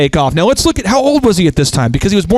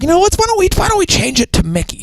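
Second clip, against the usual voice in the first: much higher, light imitation of a wife; wife's voice; 13 semitones higher than usual. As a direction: raise your pitch high to imitate her suggestion.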